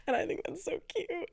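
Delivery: tiny high-pitched voice, real; squeaky gushing. Real, not acted. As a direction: squeeze it out high, melting at the cuteness. Recovering from laughing at own confession.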